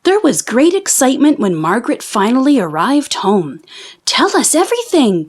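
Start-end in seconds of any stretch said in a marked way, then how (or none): 4.0-5.3 higher, eager plea for the whole story; eager cry. A narrator's reading. Sisters greeting Margaret home, begging eagerly and higher. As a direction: beg for every detail, voice lifting high. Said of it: "cried her sisters".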